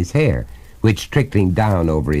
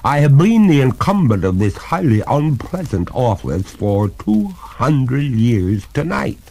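solemn ghost; 5 semitones higher than usual. Acted. Ghost solemnly states her doom.

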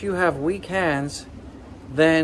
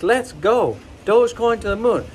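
rallying cheer; 5 semitones higher than usual. Acted. Vlogger rallying subscribers before the chant, cheering. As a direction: pump the crowd up.

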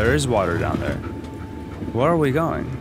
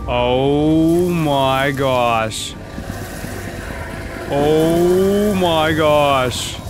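dramatic slow; 2 semitones higher, much slower than usual. Acted.

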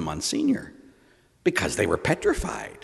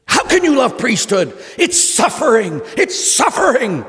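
imitated high, loud protest; exasperated outburst; 12 semitones higher, 6 dB louder than usual. Fellow priest to one who loves priesthood, loud mock exasperation. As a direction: cry it out, hammed-up and appalled.

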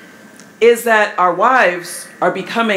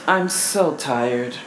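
weary sigh; 6 semitones lower, 5 dB quieter, slower than usual. Shown as a demonstration. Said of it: "say it".

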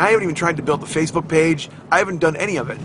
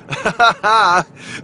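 loud, high burst of laughter; big laugh; 7 semitones higher, 5 dB louder than usual. Real, not acted. Host delighted by his banana experiment, big laugh. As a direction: laugh out loud at your own scheme.

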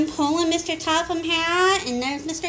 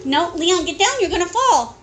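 quick, raised real warning; urgent parental warning; 2 semitones higher, 4 dB louder than usual. Real, not acted. Parent warns climbing child.

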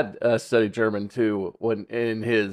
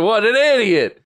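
scornful local; 9 semitones higher, 5 dB louder than usual. Retold or imitated. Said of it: "expect people to just be like".

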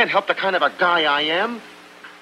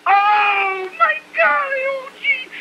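put-on much higher yelping cry, Italian accent; pained yelp; slower than usual. Tony yelps while Lena scrubs him, a high yelping cry.

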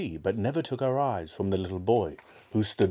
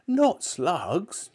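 put-on high-pitched, dismayed boy; horrified cry; 10 semitones higher than usual. A narrator's reading. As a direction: cry out in disgust as a small boy. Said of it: "George cried out".

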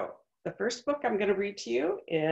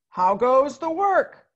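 higher, louder, slow farmer's call; 6 semitones higher, 10 dB louder, slower than usual. Acted. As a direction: call out lazily from bed. Farmer calls again.

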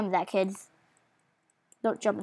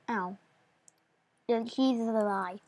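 normal talking voice

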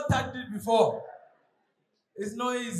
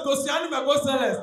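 ordinary delivery

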